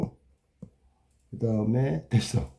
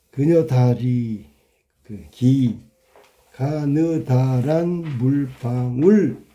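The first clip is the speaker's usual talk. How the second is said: slow reading; much slower than usual. Shown as a demonstration. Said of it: "reading examples slowly".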